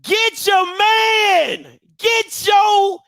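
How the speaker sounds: high, stretched-out holler; comic calling-out. Pretend. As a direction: holler in mock exasperation. Comic scolding, calling someone out.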